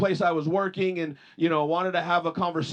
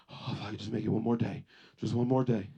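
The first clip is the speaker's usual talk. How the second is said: much lower and softer, dragging, defeated; mock exhausted groan, one more day; weary sigh of a worn-down person; 10 semitones lower, 6 dB quieter than usual. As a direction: drag it out like someone barely hanging on. Weary person dragging through life.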